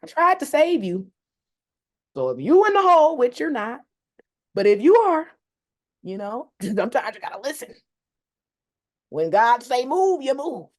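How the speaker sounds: high, loud preachy mom voice; a mock sermon from mom; imitating mom lecturing. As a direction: lecture like a mom quoting scripture. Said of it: "my mom's like".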